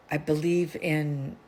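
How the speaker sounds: ordinary talk, nothing marked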